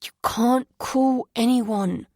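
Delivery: slow, firm and loud; adamant. Acted. Son forbidding his mother to call anyone, adamant. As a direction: lay it down, no arguing. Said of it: "He was so adamant".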